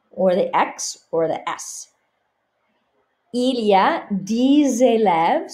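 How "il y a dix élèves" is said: dix élèves linked as diz-élèves; slow model, diz-élèves; liaison Z. Shown as a demonstration. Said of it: "So the X carries over".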